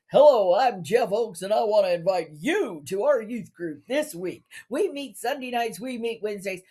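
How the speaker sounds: he invites the newcomers to youth group, mock-evangelist voice. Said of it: "nobody talks like this".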